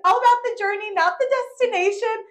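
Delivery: high, loud smug proclamation; cocky mock wisdom. Boasting to friends on loose rocks, smugly and loud. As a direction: show off before the fall. Retold cocky line before slipping.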